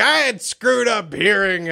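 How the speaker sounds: slow, high mock mom voice; imitating hard-of-hearing mom. Mom explaining her loudness to her kid, slow high imitation. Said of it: "my ear-infected deaf mom".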